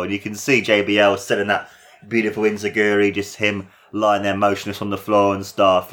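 ordinary talk, nothing marked

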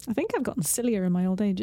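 ordinary delivery

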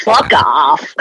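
low, put-on American accent. Shown as a demonstration. Testing whether swearing works in American, low American accent. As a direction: have another go in low-pitched American. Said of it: "You can't say fuck off in an American accent".

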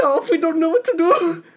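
high-pitched mock-German panic; German accent. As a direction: play a helpless German officer reporting to his leader. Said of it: "I'm doing a German accent".

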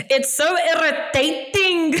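high, drawn-out South African accent; exaggerated South African impression, stretched out. Shown as a demonstration. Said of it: "give us your, your South African accent".

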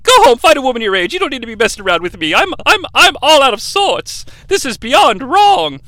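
high, agitated acted outburst; mock flustered scolding, rising in pitch; flustered rejection. Teacher sends student home, flustered.

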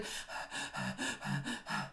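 quick shallow nervous breaths; panicky panting. Retold or imitated. Speaker reliving her sweaty confession, breathless panicky panting. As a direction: pant as if terrified and sweating. Acts out nerves, hands and palms.

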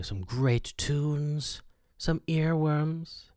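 very slow, stretched words pitched high; drawn-out DJ hype. Acted. Host plugging music lined up for listeners, drawn-out DJ hype. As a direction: draw out each phrase like a cheesy DJ. Radio host hyping the playlist.

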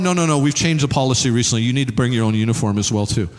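low and quick, matter-of-fact; deadpan recruiter. Acted. Recruiter tells the new soldier to bring a uniform, low and brisk.

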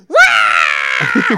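loud mock scream; loud wail. Shown as a demonstration. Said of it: "But to go".

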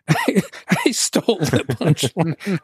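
drawn-out words breaking into laughter; laughing confession. Real, not acted. Co-host realizing he stole the punchline, laughing as he admits it. Amused confession of stealing a joke.

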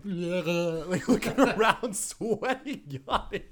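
giggling helplessly as you tell it; words breaking into real laughter; laughing. Real, not acted. Laughing while describing wedding nerves.

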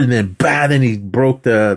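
normal talking voice

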